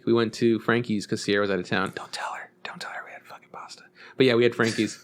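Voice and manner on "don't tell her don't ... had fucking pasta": hushed, secretive aside; conspiratorial whisper. Pretend. Secret aside about the pasta dinner.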